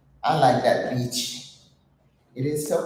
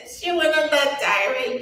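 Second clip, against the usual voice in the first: higher, laughing through the words; amused; 10 semitones higher than usual. Real, not acted. Telling her viewers about the post, amused and high. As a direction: let the laughter lift the words.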